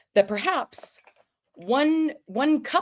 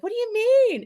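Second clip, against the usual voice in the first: very high-pitched mock confusion; high imitation of the girls; imitated bafflement. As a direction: squeal the question like baffled girls.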